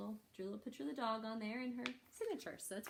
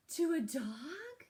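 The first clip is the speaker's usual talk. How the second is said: high, slow, loud disbelief; amazed question; 8 semitones higher, 8 dB louder, much slower than usual. Acted. Girl asks the librarian in amazement, high and slow. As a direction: stretch it out in wonder.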